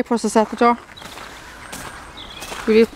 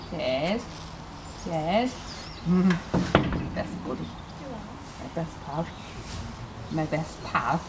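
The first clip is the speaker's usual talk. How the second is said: 1 soothing hush; 6 semitones lower, 10 dB quieter, slower than usual. Real, not acted. Soothing the stallion.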